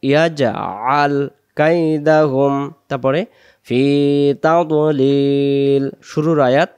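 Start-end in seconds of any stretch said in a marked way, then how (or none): 0.0-2.7 slow, drawn-out Quranic recitation. Shown as a demonstration. Teacher reciting the verse for students, slow chant. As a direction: chant each syllable long and measured.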